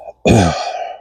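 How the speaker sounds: a hacking cough, congested chest; real chesty cough. Real, not acted. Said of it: "Chest is just full of congestion".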